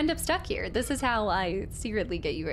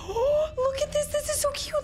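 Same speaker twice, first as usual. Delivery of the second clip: much higher, gushing over something cute; excited gasp. Real, not acted. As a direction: squeal at the adorable thing. Gasps at cute game item.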